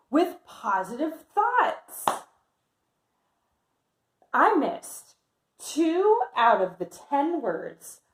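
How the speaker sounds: lively picture-book read-aloud voice; reading to children. Acted. Teacher reading Amelia's story to her class, lively.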